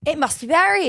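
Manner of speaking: gasp, then exclaim it in play-acted wonder; mock-awed discovery. Co-host playing an onlooker at the groundhog hole, mock-awed. Joins the imagined scene in suspense.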